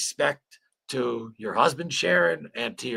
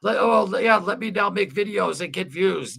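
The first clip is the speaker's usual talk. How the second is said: lightly mocking, higher and louder imitation; mock opportunistic video makers; 7 semitones higher, 6 dB louder than usual. Streamer mocking channels cashing in on [name]'s death, mock opportunist voice. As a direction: imitate those guys with raised, louder mockery. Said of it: "one of these guys who's like".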